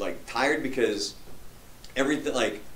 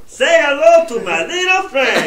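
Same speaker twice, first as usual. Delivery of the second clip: Scarface line in a much higher, louder cartoon doctor voice, slow; Doctor Nick voice; 11 dB louder, slower than usual. Pitching movie bits to cohosts, as Doctor Nick, high and loud. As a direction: squeal it out like a goofy cartoon doctor. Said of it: "Like Doctor Nick as Scarface".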